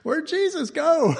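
high, laughing question; laughing mock search. Real, not acted. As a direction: ask it through laughter. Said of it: "laughing joke".

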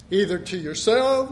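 usual speaking voice

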